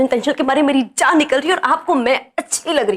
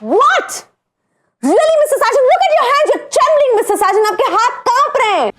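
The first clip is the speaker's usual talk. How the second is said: mocking taunt; 11 semitones higher, slower than usual. Boss taunting Sajan about his trembling hands, high mocking. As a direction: goad him, enjoying his fear.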